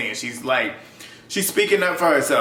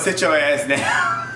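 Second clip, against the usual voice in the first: imitating Gabo's accent; 2 semitones higher, much slower than usual. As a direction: drag out "Sit your ass down" in Gabo's accent. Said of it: "his accent was funny".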